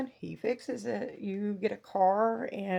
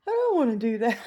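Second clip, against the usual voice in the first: put-on whiny teen refusal; 3 semitones higher, 10 dB louder, faster than usual. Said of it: "she's like".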